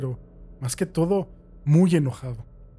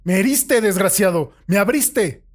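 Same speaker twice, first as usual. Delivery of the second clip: much higher, louder enraged yell; furious accusation; 7 semitones higher, 8 dB louder than usual. Acted. Wounded prisoner screams at the captor who cut him, furious.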